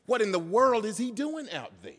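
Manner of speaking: exasperated questioning of an upstart; indignant priest. Acted. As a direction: put yourself in a baffled official's place. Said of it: "If I put myself in the position of the priests".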